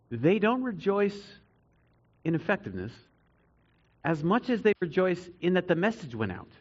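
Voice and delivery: ordinary talk, nothing marked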